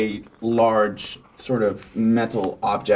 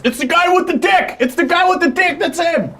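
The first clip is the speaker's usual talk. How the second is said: high, loud alarmed shout; guards shouting alarm; 4 dB louder than usual. Acted. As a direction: yell to alert the others. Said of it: "and they're".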